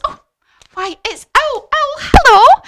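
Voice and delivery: very high, loud, excited hail; mouse greeting Owl. Acted. Mouse greeting Owl, high excited call. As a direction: call out a delighted squeaky greeting. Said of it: "the mouse says".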